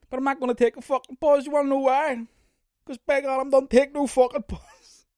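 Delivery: high, loud, fired-up declaration; mock defiant rant. Vowing to his listeners never to pause, hammed-up. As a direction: ham up a rallying cry.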